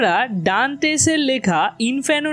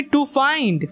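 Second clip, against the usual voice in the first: slow weak to, then a strong find; stress on find; 3 semitones higher than usual. Shown as a demonstration. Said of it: "ফাইন্ডটাকে আমরা জোরে বলবো". ("find" শব্দে জোর দিয়ে ধীরে)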